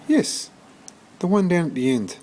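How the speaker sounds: put-on high, earnest boy's voice; a keen little boy pointing the way; boy insisting to the shelter lady, high and eager; young George. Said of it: "said George".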